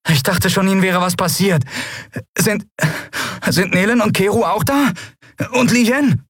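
put-on high, halting young voice; high-pitched, faltering relief; anxious relieved boy. A narrator's reading. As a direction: ask after friends with nervous relief. Anxious boy relieved to hear captain.

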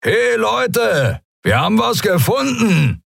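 high, strained bellow to the crew; roaring shout. A narrator's reading. Skria pirate summoning his mates to a find, roaring. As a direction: holler it through the whole ship. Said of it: "Dann brüllte er so laut".

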